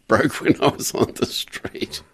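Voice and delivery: slow, breathy laugh through words; chuckling while quoting. Retold or imitated. Laughing through quoted boast.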